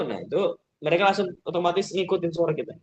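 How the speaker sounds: ordinary delivery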